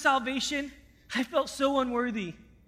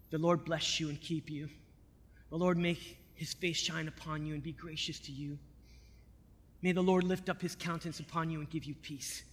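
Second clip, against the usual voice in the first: soft, low recited blessing; hushed priestly benediction; quiet blessing; 8 semitones lower, 11 dB quieter than usual. Retold or imitated. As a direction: bless him gently and quietly. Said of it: "and I said".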